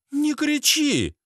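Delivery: high, slow female voice; reproving woman. Acted. Lena tells Sasha off, high slow reproof.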